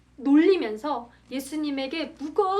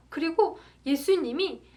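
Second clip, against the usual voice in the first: ordinary delivery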